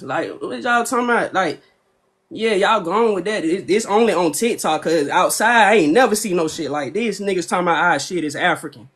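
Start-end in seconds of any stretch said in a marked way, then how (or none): none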